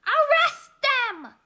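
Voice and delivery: loud, slow barked order; furious boss ordering the cops; angry order. Acted. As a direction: command it furiously. Angry mayor orders arrest.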